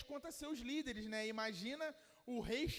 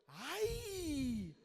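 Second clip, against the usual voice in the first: a gasping cry of wonder; mock amazement at a passing car; amazed ai; 4 semitones higher than usual. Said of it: "ai de admiração".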